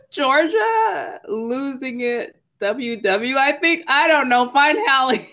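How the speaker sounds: real embarrassed laughter while fumbling a name; laughing. Real, not acted. Guest forgetting her own Instagram name, laughing high. Giggling, can't recall own handle.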